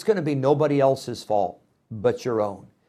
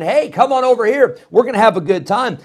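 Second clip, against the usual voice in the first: high, loud come-hither call; hammed-up party invitation; enticing call; 9 semitones higher, 8 dB louder, faster than usual. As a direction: wave the simple ones over.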